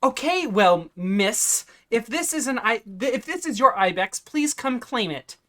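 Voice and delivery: high-pitched, insistent appeal; exasperated. Acted. Shopkeeper telling the owner to claim the ibex, exasperated. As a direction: plead loudly and testily for action.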